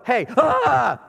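a sudden playful "Hey, ah!" jump-scare; mock startle shout. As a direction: leap out at them with a mock yell. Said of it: "you just walk up and go".

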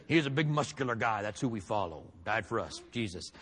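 low mock tough-guy voice; comic book hero fan. Mocking those who want a muscular Jesus, low mock-tough voice. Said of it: "you want a comic book Jesus".

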